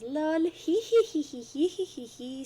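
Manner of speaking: high, loud, slow mocking cackle; troll cackle. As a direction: taunt him with a cackle. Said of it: "And she says".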